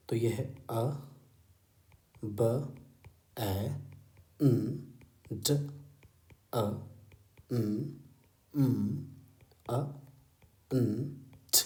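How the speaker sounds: slow letter-by-letter sounding out; breaking the word into its sounds. Shown as a demonstration. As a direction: spell it aloud sound by sound. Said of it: "sounding out letters slowly".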